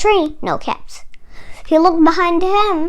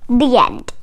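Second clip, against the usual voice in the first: plain reading voice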